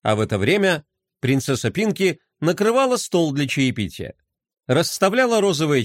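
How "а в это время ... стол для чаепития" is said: plain reading voice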